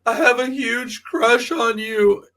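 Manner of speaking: put-on high, slow, breathy-free kid confession; mock sweet boy voice; lovestruck little boy. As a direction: draw it out like a shy smitten kid. Little boy confessing crush, retold.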